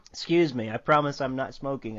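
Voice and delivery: ordinary delivery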